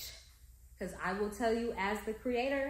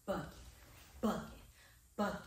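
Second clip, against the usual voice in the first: clipped bucking with the punch on buh; bucking, bucking, bucking punched on the first beat; stressed buh; 3 semitones lower, 6 dB quieter, much faster than usual. Shown as a demonstration. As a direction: hit the buh in bucking hard. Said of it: "So she's saying".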